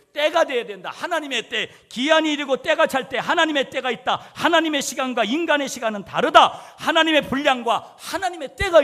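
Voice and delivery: loud, high, insistent sermon delivery; preaching at full force. Acted. Pastor exhorting his congregation, preaching at full force. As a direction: drive it home to the pews.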